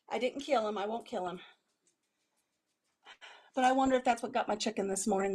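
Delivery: usual speaking voice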